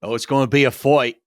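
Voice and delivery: laughing, Australian "fight" said as "foyt"; a hammed-up Aussie, laughing; bad Australian accent. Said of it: "no more bad Australian accents".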